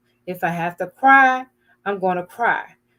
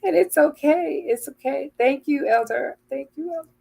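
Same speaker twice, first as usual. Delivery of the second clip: higher, choked-up voice; trying not to cry; 5 semitones higher, faster than usual. Real, not acted. Thanking the elder while trying not to cry, choked up. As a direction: fight back real tears as you speak. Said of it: "I'm trying not to cry myself".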